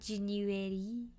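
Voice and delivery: "January" drawn out slowly with a soft French "zh" start; mimicking odd pronunciation. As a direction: echo "January" teasingly, slow, soft and rising at the end. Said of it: "why I said it like that".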